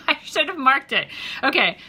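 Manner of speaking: ordinary delivery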